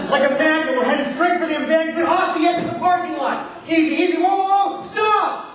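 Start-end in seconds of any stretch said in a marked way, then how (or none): 3.7-5.5 alarmed calls. Retold or imitated. Daughter heading for embankment.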